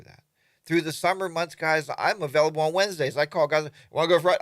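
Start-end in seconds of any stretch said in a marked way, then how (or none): 3.9-4.4 louder, keen invite to ride; eager buddy asking. Retold or imitated. To his riding buddies, an eager loud invite. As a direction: pitch the ride like you can't wait.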